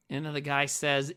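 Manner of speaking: normal talking voice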